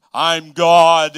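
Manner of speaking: huge, booming announcement of God. Retold or imitated. God announcing himself over a big speaker, booming. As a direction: boom it out like a voice from the sky. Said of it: "speak to us in this big speaker".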